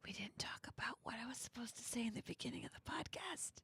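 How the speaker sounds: urgent hushed whisper; whisper yelling. Real, not acted. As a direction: whisper it, worried, on air. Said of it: "Why are we whispering?".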